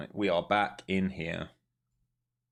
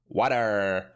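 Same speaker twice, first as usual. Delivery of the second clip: louder American "Water" with a D sound; mock American accent; 4 dB louder than usual. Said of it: "Water with a D".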